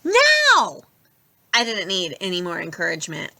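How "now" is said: high, loud urgent yell. A narrator's reading. Annabeth yells at everyone to flee, high and loud. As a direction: shout them off the bus. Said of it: "Annabeth yelled".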